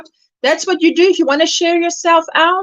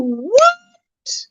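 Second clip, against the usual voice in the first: very high, drawn-out what; mock amazement; 14 semitones higher than usual. Streamer announcing a new tutorial to viewers, playful amazement. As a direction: stretch it upward in playful shock.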